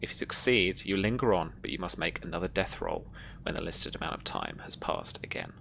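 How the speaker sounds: normal talking voice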